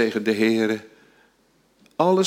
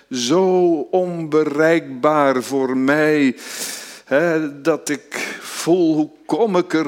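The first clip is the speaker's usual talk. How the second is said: drawn out, earnest plea; slow earnest sermon plea; slow pleading preaching; 4 semitones higher, 3 dB louder, slower than usual. Acted. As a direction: plead slowly from the pulpit.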